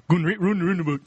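Geordie accent. Shown as a demonstration. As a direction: say it like a Geordie, barely moving the mouth. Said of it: "you say it in a Geordie accent".

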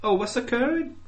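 high, lilting Welsh; sing-song mock Welsh question. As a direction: rise up at the end in Welsh. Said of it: "A bit like that".

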